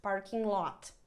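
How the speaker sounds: normal talking voice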